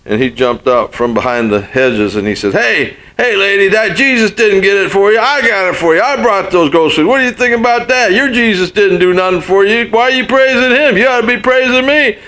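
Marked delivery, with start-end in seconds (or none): 2.5-12.2 high, mocking grumpy old man calling out; grumpy neighbor's taunt. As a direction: jeer at her from behind the hedge.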